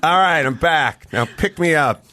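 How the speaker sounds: hammed-up drunk ranting at his car; belligerent drunk. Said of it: "I'd start getting belligerent".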